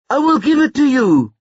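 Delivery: very high, panicked promise; desperate cry. A narrator's reading. Brother promises the kidnapper the card, crying out desperately. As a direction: cry out in frantic surrender. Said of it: "screamed Tyrone".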